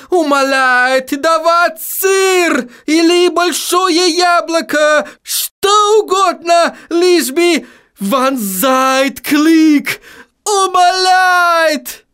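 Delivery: high, slow, desperate German vampire pleading; having a heart attack. A narrator's reading.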